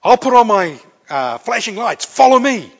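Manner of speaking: loud, urgent-sounding order; traffic officer. Acted. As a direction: call it out high over the traffic. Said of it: "and a traffic officer said".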